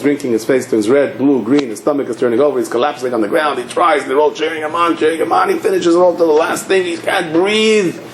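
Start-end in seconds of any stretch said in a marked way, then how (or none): 3.6-8.0 breathless-race storytelling, rising to the climax; building excitement. Retold or imitated. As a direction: pitch climbing as the struggle peaks. Building drinking contest, cheered on.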